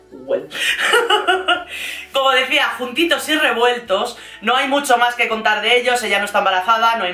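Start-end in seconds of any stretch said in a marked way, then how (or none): 0.5-2.1 high, airy giggle; real laugh at your own joke; breathy high chuckle; amused laugh. Real, not acted. Laughs at own influencer parody.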